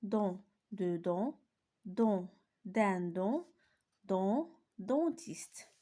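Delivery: "don, dentiste" stretched very slowly; slow teacher drill; slow repetition drill. Shown as a demonstration. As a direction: read the list slowly for the class. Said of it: "je répète".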